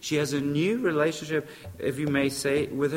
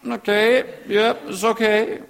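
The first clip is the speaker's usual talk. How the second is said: grudging okay; 4 semitones higher than usual. Retold or imitated. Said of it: "Forgive you is".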